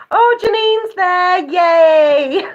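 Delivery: high, slow, delighted oh; thrilled to see a friend; excited squeal. Real, not acted. Delighted greeting a viewer.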